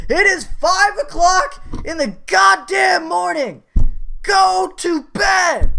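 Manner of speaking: full-force high-pitched re-enacted scream. Son screaming at drunk dad and German guy, furious high shout. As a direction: scream it as an order, dragging out the last words. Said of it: "I just screamed at them".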